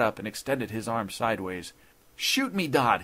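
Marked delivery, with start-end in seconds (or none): 2.2-3.0 high, urgent plea; begging. A narrator's reading. Judge begging the intruder to fire, high desperate plea. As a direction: beg him desperately, falling on the name. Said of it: "he begged".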